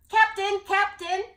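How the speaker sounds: high, loud calling out. Acted. Jubal calling Captain through the cabin door, loud. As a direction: call up the ladder eagerly. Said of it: "he called".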